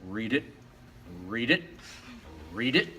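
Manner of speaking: low, rising frog ribbit; frog croak. Acted. Frog answers the chicken by the pond, low ribbit. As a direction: croak it like a ribbit, lifting at the end. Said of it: "the frog goes".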